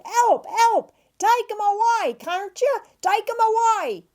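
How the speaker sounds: very high, panicked Cockney wail; hammed-up high Cockney cries of distress; crying for help. A narrator's reading. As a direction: plead to be rescued in a shrieky high voice. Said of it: "he cried".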